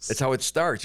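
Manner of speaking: ordinary talk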